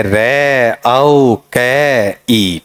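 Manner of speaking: रे औ के ई very slow, each sound held separately; sounded out in parts. Shown as a demonstration. Teacher breaks the word apart for pupils, very slowly. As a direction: break रे औ के ई into long separate pieces. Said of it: "ऐसा part है".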